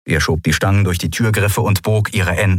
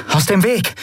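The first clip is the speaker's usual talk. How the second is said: higher, sharp demand; demanding order; 12 semitones higher than usual. Acted. Endriel ordering the pirates aside, demanding. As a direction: clear the path with authority. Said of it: "forderte Endriel".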